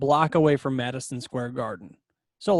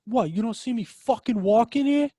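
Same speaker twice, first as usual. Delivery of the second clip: put-on higher-pitched, indignant New York accent; 7 semitones higher than usual. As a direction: imitate an irate New Yorker in a New York accent. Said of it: "pretty good New York accent impersonation".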